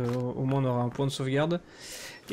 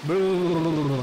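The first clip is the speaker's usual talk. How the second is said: mocking blabber; 3 dB louder, much slower than usual. Mocking the enemies' chatter.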